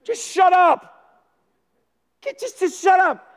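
fed-up shout at the whole boat; annoyed shout. Acted. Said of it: "trying to talk to them too".

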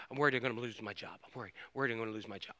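fast, soft anxious mutter; a quick, hushed voice of someone fretting; mimicked worried person. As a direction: rattle off the worry under your breath. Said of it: "We're worried that this".

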